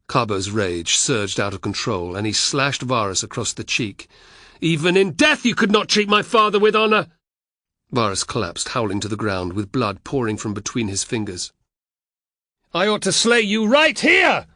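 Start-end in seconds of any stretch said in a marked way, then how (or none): 4.6-7.1 loud, high outburst of rage; furious shout. A narrator's reading. Carbo enraged, slashing his uncle. 12.7-14.5 loud, high raging threat; furious shout. A narrator's reading. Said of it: "Carbo shouted".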